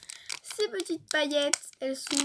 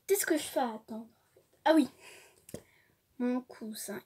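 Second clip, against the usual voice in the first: ordinary talk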